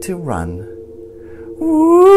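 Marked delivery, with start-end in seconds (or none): 1.6-2.2 very high loud wailing woo; ghost wail. A narrator's reading. Big green ghost scaring tired monsters, loud wail. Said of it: "wails the big green ghost".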